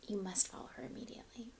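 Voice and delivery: ordinary delivery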